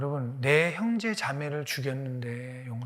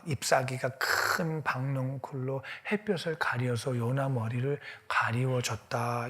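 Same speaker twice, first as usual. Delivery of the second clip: ordinary talk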